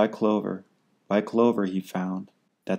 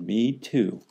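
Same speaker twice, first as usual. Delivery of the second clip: put-on young kangaroo. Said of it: "the young kangaroo in her pouch said".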